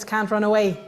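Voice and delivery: ordinary talk, nothing marked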